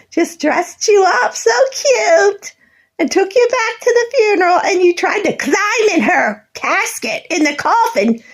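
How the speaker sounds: high voice breaking with tears; crying. Real, not acted. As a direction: let the tears break through, half laughing. Recalling funeral, crying.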